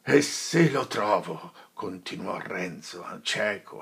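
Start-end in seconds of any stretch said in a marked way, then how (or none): 0.0-1.5 slow, louder threat; a slow angry threat; blind with anger. Acted. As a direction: menace him. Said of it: "affatto dalla collera".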